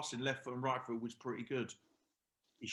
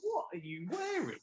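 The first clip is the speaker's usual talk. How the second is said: mocking jibe; 9 semitones higher than usual. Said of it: "We'd all given it".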